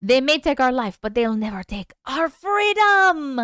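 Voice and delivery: mock-heroic shout; mock battle cry. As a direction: declaim it like a movie hero, stretching the end. Acts out a famous battle cry.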